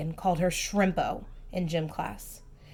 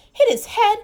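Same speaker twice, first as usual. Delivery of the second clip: much higher, louder burst; dramatic exclaim; 14 semitones higher, 9 dB louder than usual. A narrator's reading. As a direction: exclaim the crash. Ronald tumbles dramatically.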